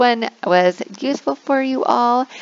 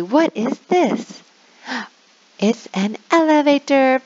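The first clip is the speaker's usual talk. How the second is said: higher, playful gasp and answer; mock gasp reveal; 5 semitones higher than usual. Shown as a demonstration. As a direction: act amazed for the kids. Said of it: "So you say".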